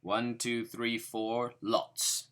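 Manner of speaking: loud gruff orc counting. Pretend. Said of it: "count like an orc".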